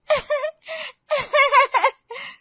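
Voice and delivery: loud high giggling fit; high burst of laughter. Acted. Friend laughs at Naina's complaint over the phone, high laughter. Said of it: "तुझे मेरी बर्बादी पर हंसी आ रही है सुमन?".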